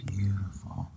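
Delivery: soft hushed whisper. Real, not acted. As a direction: whisper it in awe. Lighting the candle for ASMR.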